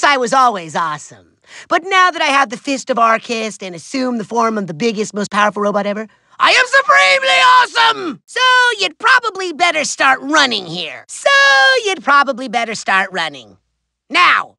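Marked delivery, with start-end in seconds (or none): 6.4-8.2 high, fast crowing boast; boastful shout. Acted. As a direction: proclaim it like a megalomaniac at his peak. Villain boasts of power.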